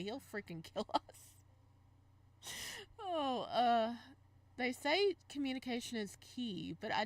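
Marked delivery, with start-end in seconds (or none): 3.0-3.9 louder whimpering oh; pained whine. Real, not acted. Gamer cringing at a scene, whiny cry. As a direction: whine in embarrassment.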